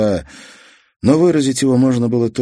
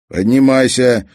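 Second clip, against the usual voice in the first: sharp loud command upward; commanding order; 5 semitones higher, 7 dB louder than usual. Acted. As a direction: order him to climb. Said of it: "приказал Дик". (громкий приказ)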